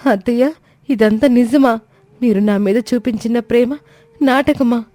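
put-on high, hurt young bride, near tears; a betrayed young wife asking in disbelief; hurt daughter-in-law. Komali confronting her mother-in-law, high and hurt.

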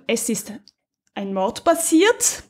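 ordinary delivery

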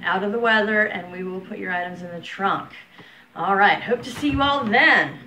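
usual speaking voice

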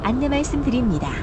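ordinary talk, nothing marked

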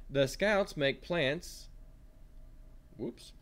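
ordinary talk, nothing marked

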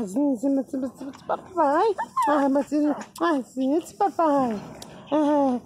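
put-on high cooing baby voice; baby talk. Cooing to his little dog, high baby talk. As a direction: fuss over the puppy lovingly.

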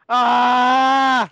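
real long pained scream; long cry of terror. Real, not acted. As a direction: scream out loud under attack. Caller attacked by a bear.

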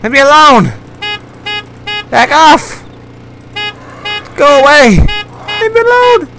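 high frantic shouts; being chased. Real, not acted. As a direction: shoo the chasers away. Fending off chasing prey.

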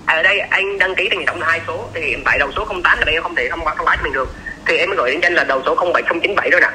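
usual speaking voice

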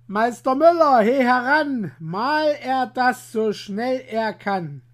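loud call across the yard. Acted. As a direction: bellow for the miller to come. Farmer calling the miller.

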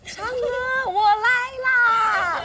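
stretched slow-motion cry, high and laughing. Shown as a demonstration. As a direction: drag every syllable out like a movie slow-mo. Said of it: "而且你跑过来要slow motion".

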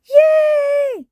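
very high, loud squeal of joy; children's excited cheer. A narrator's reading. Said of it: "cheered Peppa and Susie".

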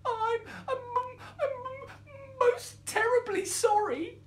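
tiny high voice, stammering and tearful; squeaking mouse. A narrator's reading. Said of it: "squeaked the mouse".

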